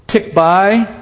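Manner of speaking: ordinary talk, nothing marked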